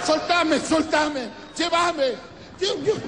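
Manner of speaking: high, desperate wailing pleas; mock frantic wailing. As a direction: struggle against people holding her back. Widow trying to climb into coffin.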